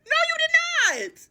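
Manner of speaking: very high, stretched disbelief; no you did not, squeaked high; indignant denial. Real, not acted. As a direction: shoot it way up in outrage. Denying he told her.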